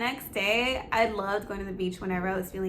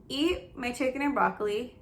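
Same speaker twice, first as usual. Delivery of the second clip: ordinary delivery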